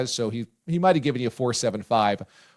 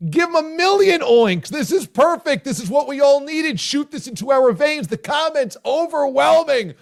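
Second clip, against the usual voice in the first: much higher, loud, shouting hype; excited praise; 12 semitones higher, 6 dB louder than usual. Real, not acted. Co-host shouts praise for the host's recap. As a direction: rave about it at full volume.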